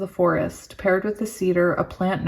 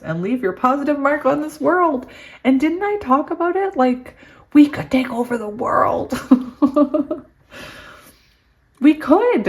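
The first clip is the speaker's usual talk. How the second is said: giddy excitement; 8 semitones higher, 3 dB louder than usual. Real, not acted. Giddy talk of taking over world.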